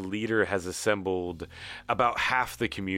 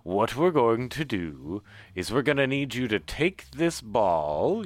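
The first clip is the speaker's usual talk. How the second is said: normal talking voice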